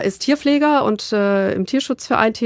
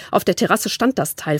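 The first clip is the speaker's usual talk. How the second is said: plain reading voice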